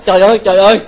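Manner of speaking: loud, startled trời ơi; loud horrified exclamation; shocked cry. Retold or imitated. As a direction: cry out in dismay like the shocked woman. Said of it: "Cô".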